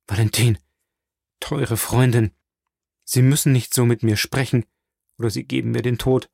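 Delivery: usual speaking voice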